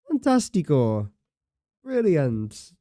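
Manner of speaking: bad Italian accent. Gamer cheering own work in a bad Italian accent. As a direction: put on a terrible mock-Italian accent. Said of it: "That was the worst Italian accent".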